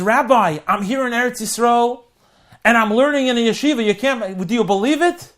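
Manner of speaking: can't contain the excitement; eager young man on the phone to his rabbi; thrilled phone call. Acted. Joe calls the rabbi, thrilled.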